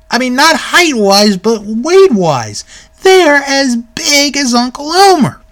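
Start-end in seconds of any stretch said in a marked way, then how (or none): 3.0-5.4 whole phrase pitched high, "big" stressed; emphatic repetition. Real, not acted. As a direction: insist on "big" with a high, incredulous push. Repeats size claim emphatically.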